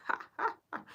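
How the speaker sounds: high breathless mock ha ha ha; psycho laugh. Showing chat the driver's scary laugh, high airy mock cackle. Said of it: "and then they go".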